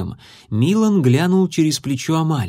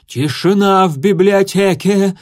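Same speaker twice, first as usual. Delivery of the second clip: loud, higher dragon librarian snap; dragon puff; 7 semitones higher, 5 dB louder than usual. A narrator's reading. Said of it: "пыхнула мадам Жаропых".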